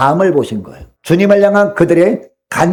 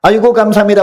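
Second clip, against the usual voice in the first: higher-pitched, lightly acted thanks; imagined grateful thanks; 6 semitones higher, 3 dB louder than usual. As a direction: toss off a mock thank-you. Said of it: "이 사람들이 어떻게 해요". (가볍게 흉내 낸 감사 인사)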